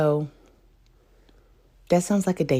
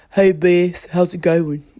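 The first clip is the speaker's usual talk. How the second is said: hammed-up, louder impression of a friend; exaggerated mock voice of a male pal; Fish impersonation; 5 dB louder, slower than usual. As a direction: do your silly take on a buddy's drawly greeting. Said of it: "I apologize for my Fish impersonation".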